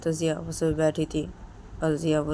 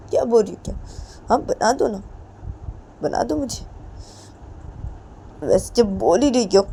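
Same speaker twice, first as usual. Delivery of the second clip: higher and louder, mocking; sarcastic angry taunt; 4 semitones higher, 5 dB louder than usual. Ritu mocking Jiya, higher louder sarcastic taunt. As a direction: goad her bitterly.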